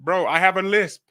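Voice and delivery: lisping through braces. Real, not acted. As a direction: lisp on every s.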